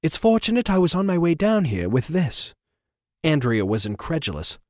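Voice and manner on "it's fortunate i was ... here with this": higher, smug theatrical voice; smooth, superior intervention; fussy gentleman. Acted. Trevor voiced.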